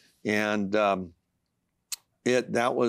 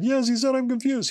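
much higher voice mimicking his wife; imitating wife; 14 semitones higher, 3 dB louder than usual. Husband retelling his wife's phone call, high excited voice. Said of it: "And she said".

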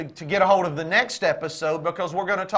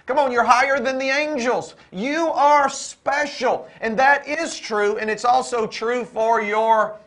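raised, ringing sermon voice with stretched key words; building, fervent preacher's cadence; impassioned preaching; 3 semitones higher, 4 dB louder than usual. Real, not acted. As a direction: drive each phrase home to the congregation. Preaches at full force.

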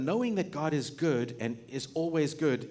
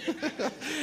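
high giggle; 12 semitones higher than usual. Real, not acted. Laughs at his own story.

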